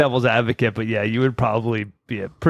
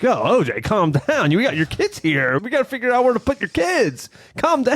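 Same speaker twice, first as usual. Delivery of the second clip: high, mocking officer voice; mock cops shushing him; mocking police; 9 semitones higher than usual. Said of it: "then they're like".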